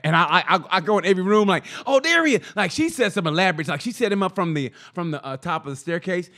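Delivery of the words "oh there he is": high, loud little gotcha; excited discovery. Retold or imitated. Roommate searching rooms for the elf, excited. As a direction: pounce on the find like a treasure hunt. Hunting for the elf each morning.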